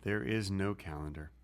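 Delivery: low, hushed correction; quiet insistence. Real, not acted. Correcting his co-host about the calendar, quiet insistence.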